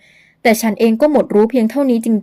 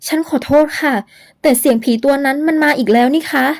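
put-on higher, frightened girl's voice; startled young woman; 6 semitones higher than usual. Young woman warns Luang of the ghost, higher and frightened. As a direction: gasp it out to Luang, scared and high.

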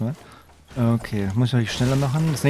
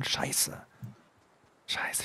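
voiceless, very soft swear; whispered curse; 15 dB quieter than usual. Real, not acted. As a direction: curse under your breath. Cursing under breath, must run far.

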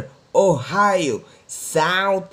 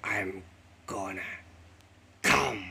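villain voice; 12 semitones lower, 7 dB quieter than usual. Pretend. Defeated villain vows revenge.

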